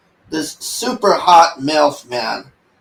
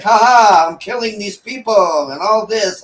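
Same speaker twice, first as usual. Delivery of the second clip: mock-gleeful cackle, higher and louder at first; mocking the wife; 6 semitones higher, 4 dB louder than usual. Reviewer mocking the wife's gleeful killing, mock cackle. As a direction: sneer out a gleeful killer's boast.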